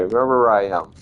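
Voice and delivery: chewing while talking; mouth full. Real, not acted. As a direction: speak around a bite of food. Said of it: "got my mouth full".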